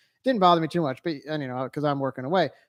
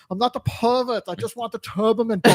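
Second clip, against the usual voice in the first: put-on a light Austrian accent, a bit higher and louder; Arnold Schwarzenegger; 8 semitones higher, 5 dB louder than usual. Arnold fleeing angry moms in a light Austrian accent. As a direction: quote the line in a mild Austrian action-hero accent. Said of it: "That was a great accent".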